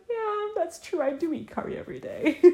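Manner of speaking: high-pitched, play-acted meek agreement; mock agreeable. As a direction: pitch it way up, pretending to go along. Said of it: "I'm just gonna be like".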